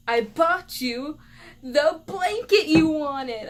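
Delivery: loud, high, very slow mom voice; imitating a mom proudly unveiling her present; triumphant gift reveal. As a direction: draw out each word, building to a big reveal.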